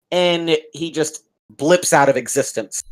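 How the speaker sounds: ordinary talk, nothing marked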